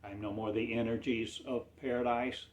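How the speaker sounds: usual speaking voice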